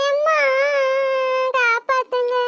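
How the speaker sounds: put-on high child voice, desperate calls for rescue; a small girl screaming for her mother from the water; tearful high-pitched pleading; little girl crying for help.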